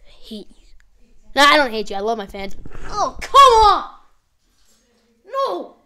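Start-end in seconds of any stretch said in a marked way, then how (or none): 0.0-0.6 breathy mock-hateful whisper; playful whispered spite; whispered grudge. Real, not acted. 2.9-5.8 loud, rising cries of dismay; higher, louder pained protest; anguished outburst. Real, not acted. Reacting to a painful replay.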